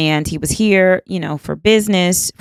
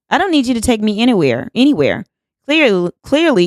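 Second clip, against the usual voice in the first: quick, higher-pitched retort; retold irritated brush-off, repeated for emphasis; indignant rebuff; 6 semitones higher than usual. Rebuffing offer, earlier offended and irritated.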